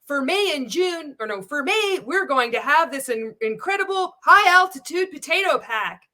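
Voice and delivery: high, loud mock presenter read; hammed-up, swooping sales pitch; exaggerated promo practice. Shown as a demonstration. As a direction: overdo it like a stiff sales video. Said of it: "when you put up your phone and you're practicing".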